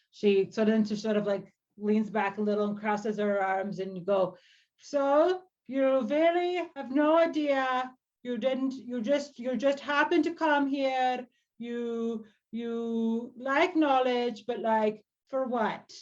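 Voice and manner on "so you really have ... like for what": slightly high, drawn-out elder voice; suspicious old druid. Acted. Old druid with crossed arms questions why she came, sceptical. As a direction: question the newcomer with measured doubt.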